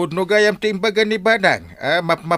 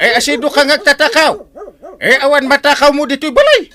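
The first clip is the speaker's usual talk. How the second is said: angry shout; 9 semitones higher, 7 dB louder than usual. Acted. Confronting a suspected thief.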